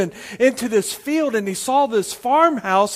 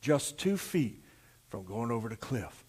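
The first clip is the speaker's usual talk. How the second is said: hushed suspense; 8 semitones lower, 11 dB quieter than usual. A narrator's reading. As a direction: drop right down and space it out. Punchline suspense of the horse story.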